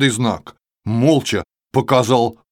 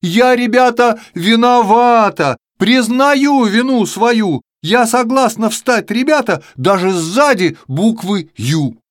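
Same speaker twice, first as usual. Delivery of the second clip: tearful confession; 7 semitones higher, 3 dB louder than usual. Acted. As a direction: confess through mock tears. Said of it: "а потом как заревёт".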